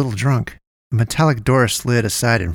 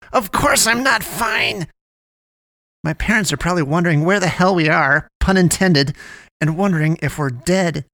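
high-pitched aggrieved complaining; upset rant; 6 semitones higher than usual. A narrator's reading. Poe, hurt from the crash, rants at Damien, upset. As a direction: pile up the grievances in a higher, fed-up voice.

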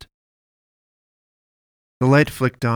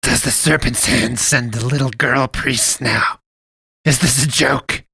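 scornful fat priest; 3 semitones higher, 6 dB louder than usual. A narrator's reading. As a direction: sneer at the little girl priest. Priest figure mocking.

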